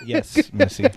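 a touch of Cajun twang; Cajun accent. Pretend. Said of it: "Are you doing a Cajun accent now?".

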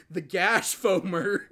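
slow words through laughter; laughing. Real, not acted. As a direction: giggle through the word. Cracking up over name.

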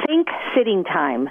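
ordinary talk, nothing marked

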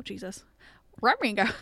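ordinary talk, nothing marked

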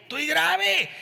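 "grave" high and falling; high whiny repeat. Shown as a demonstration. Same high whine said again. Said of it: "repeated take".

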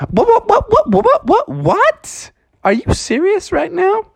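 rapid high-pitched what-what-what; shocked disbelief. Acted. Froggy can't believe the koala hates flies, squealing high. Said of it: "And Froggy said".

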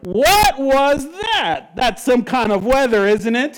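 loud, high, stretched-out amazement; astonished onlooker. Acted. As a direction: cry out as a stunned witness, starting slow. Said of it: "If you're in there in the scene, you're realizing".

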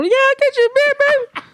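very high, sing-song eager voice; a high sugary yes to his girlfriend while broke; fake cheerful agreement. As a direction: play the too-eager boyfriend. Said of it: "like a dumbass".

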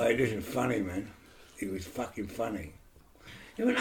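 ordinary talk

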